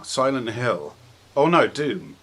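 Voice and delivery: ordinary delivery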